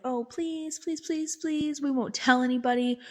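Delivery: high, insistent mimicked begging; pleading. Family begs the therapist to break the rule, pleading.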